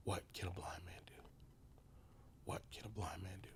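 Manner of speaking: whispered to himself, soft; inner whisper. Retold or imitated. Blind man asking himself quietly, whispering. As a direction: ask yourself under your breath. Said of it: "I kept asking myself".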